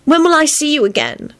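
high, plaintive question. A narrator's reading. Elizabeth asking Lupin when they meet again, plaintive. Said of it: "she asked plaintively".

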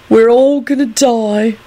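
slow, high, drawn-out moan; pitiful moan. A narrator's reading. Clive lamenting from his bed, moaning pitifully. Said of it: "Clive moaned pitifully".

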